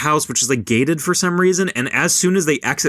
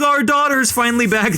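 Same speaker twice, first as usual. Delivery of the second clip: much higher, bright relief; overjoyed parents; 11 semitones higher than usual. Retold or imitated. Parents welcoming lost daughter home, gushing high. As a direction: gush it, way up high.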